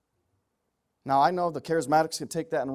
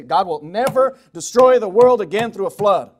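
loud, confident proclamation from the pulpit; firm, emphatic preacher's assertion; bold declaration; 3 semitones higher, 11 dB louder than usual. Real, not acted. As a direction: declare it boldly, leaning on never. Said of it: "I can stand here boldly and say".

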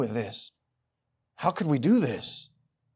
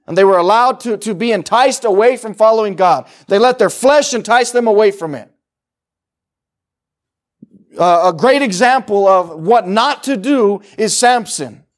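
higher, louder preaching that drives every line; full-force preaching; 8 semitones higher, 13 dB louder than usual. Acted. Preacher warning the church about apostasy, full-force preaching. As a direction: thunder it at the congregation.

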